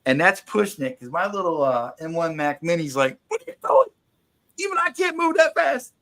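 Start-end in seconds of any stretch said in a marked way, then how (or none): 3.3-5.9 high, strained computer voice straining to keep up; voiced Mac Mini. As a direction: play the overworked little computer crying out.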